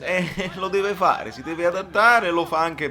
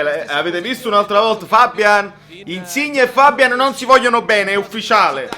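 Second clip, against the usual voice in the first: ordinary talk, nothing marked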